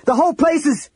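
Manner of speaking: high-pitched urgent yell, cut off; shouted warning. A narrator's reading. Tanis warning companions of the falling inn, shouted. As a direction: yell it in alarm, breaking off mid-sentence. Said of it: "Tanis shouted".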